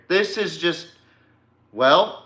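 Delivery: ordinary talk, nothing marked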